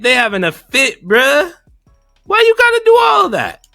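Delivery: usual speaking voice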